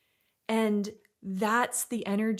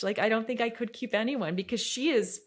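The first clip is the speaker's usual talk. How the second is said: usual speaking voice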